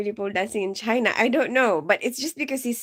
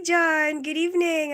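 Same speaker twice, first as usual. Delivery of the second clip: usual speaking voice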